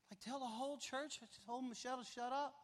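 lightly acted incredulous protest, pitched high; mock disbelief. Acts out disbelief at God's request.

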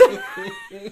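squealing laugh that breaks off; high laugh-cry. Real, not acted. Laughs after self-scolding.

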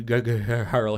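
usual speaking voice

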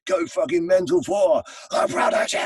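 high, loud ragged shout; all-out band introduction roar; hyping the crowd. Retold or imitated. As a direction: yell it like a festival stage announcer. Retold stage introduction to festival crowd.

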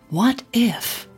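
plain reading voice

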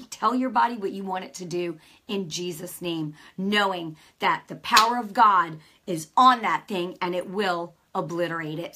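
ordinary delivery

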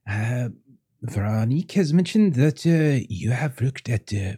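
put-on halting French-accented voice, lifting into questions; curious French host. French host asking guest about hidden chambers, curious and hesitant. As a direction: inquire politely and slowly with a French accent. Game master plays the French husband.

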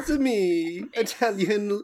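high cartoon Italian, "It's a me"; bad Italian accent. Giving Salma a bad Italian accent, hammed-up and high. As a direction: play a hammed-up video game Italian. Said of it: "just really bad Italian".

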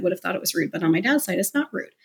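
ordinary delivery

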